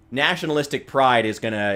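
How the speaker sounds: ordinary talk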